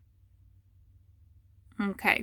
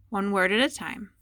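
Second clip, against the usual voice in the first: ordinary talk, nothing marked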